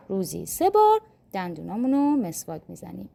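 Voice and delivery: ordinary talk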